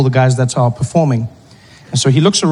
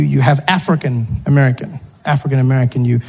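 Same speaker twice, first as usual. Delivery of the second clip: ordinary delivery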